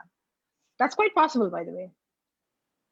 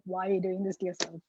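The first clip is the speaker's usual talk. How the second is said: quiet, lowered aside; silent inner remark; 3 semitones lower, 7 dB quieter than usual. Retold or imitated. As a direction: say it under your voice, as if thinking. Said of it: "in my head, literally, I was like".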